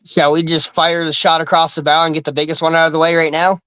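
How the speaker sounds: ordinary talk, nothing marked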